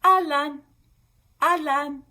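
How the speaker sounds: אהלן repeated as ah-LAN, high and loud for the class; call and response. Shown as a demonstration. Teacher prompting kids to repeat אהלן, ah-lan. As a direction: model אהלן again, ah-lan, inviting them. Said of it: "Can you say it back to me".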